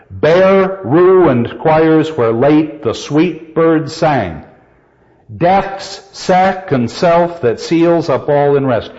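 slow verse reading, heavy separate beats on "Bare, ruined" and "Death's second"; weight "Bare" and "Death's" to show the repeated rhythm; matching spondee openings. Shown as a demonstration. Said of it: "Let me just read the two together".

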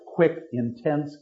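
ordinary talk, nothing marked

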